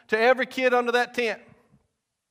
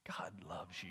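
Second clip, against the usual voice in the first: very low, hushed near-whisper; imitating preacher; 13 semitones lower, 21 dB quieter than usual. Youth preacher telling the crowd the key message, hushed. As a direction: drop right down, barely audible, and let it land. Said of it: "this is what he does".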